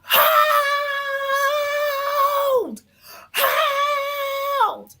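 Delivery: high, loud mock scream of hold. Drunk woman screaming hold in the kitchen, loud and high. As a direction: scream hold at the top of her lungs. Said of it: "She starts screaming, to the top of her lungs".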